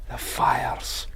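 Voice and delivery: breathless whisper of the fires; eerie whisper. Pretend. Stranger whispering to the pricker during a fake vision, hushed. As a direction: drop to a whisper as if seeing them.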